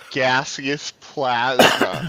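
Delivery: very slow, every syllable spelled out; over enunciating. Shown as a demonstration. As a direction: say Gaseous plasma slowly and carefully. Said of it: "Let me say it slowly".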